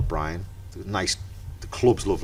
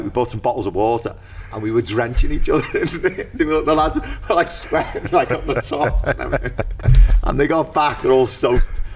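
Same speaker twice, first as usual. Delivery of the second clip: higher, amused, bubbling with laughter; giddy retelling; 6 semitones higher than usual. Real, not acted. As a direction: tell it like you can barely hold in the laugh. Laughing at the hilarious memory.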